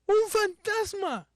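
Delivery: high, loud, drawn-out cry of alarm; frightened cry. Acted. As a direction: cry out like startled disciples. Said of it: "los discípulos se turbaron diciendo".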